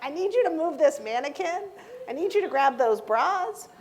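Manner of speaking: loud, high mock command; playful bossy order. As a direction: boss the stock guys around, teasingly. Mock-ordering stock guys around.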